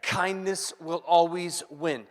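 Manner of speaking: slow, stretched "kindness will always win"; slow emphatic. Acted. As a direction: land it slowly. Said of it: "can I just say".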